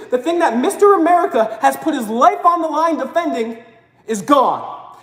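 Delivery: ordinary talk, nothing marked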